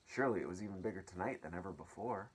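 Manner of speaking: ordinary delivery, nothing marked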